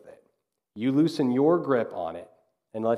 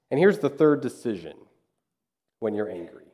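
normal talking voice

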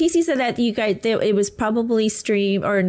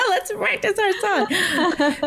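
laughing; 8 semitones higher, much slower than usual. Retold or imitated. Repeating partner's quote laughing.

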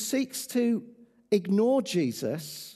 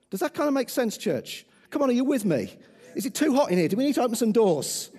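rally speech; 4 semitones higher, 4 dB louder, faster than usual. Acted. Rousing the congregation.

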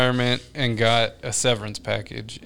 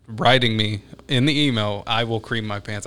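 usual speaking voice

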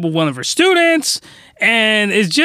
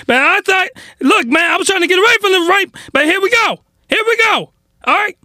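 outraged rant; 13 semitones higher, faster than usual. Real, not acted. Reviewer shouting at the anime for calling itself wholesome, in a real furious rant. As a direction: yell it out, fed up.

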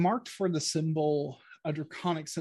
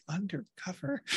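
incredulous undercover; 4 semitones higher, 7 dB quieter than usual. Retold or imitated. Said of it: "Vitha's face is like".

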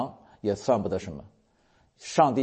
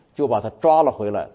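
ordinary delivery

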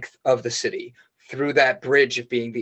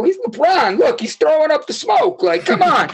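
excited retort; 12 semitones higher, 3 dB louder than usual. Retold or imitated. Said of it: "And I go".

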